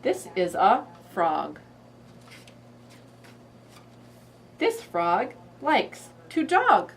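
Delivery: slow, exaggerated picture-book reading; reading to a toddler. Shown as a demonstration. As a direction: read it slowly to a little one. Reads frog book.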